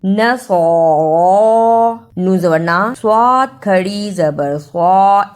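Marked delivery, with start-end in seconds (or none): 0.0-2.1 "नसरा" very slow, both long vowels held; drawn-out, sustained ending on "नसरा"; elongated vowels. Shown as a demonstration. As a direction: stretch the heavy -सरा of "नसरा", the last vowel longest. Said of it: "इस पे थोड़ी सी देर लगाएं".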